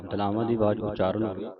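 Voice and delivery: ordinary talk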